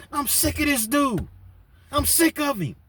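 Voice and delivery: high, heated shout of fed-up anger; angry outburst. Retold or imitated. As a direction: spit it out, completely fed up. Sean raging at Omar.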